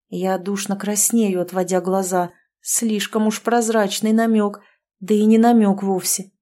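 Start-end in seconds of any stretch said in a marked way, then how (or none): none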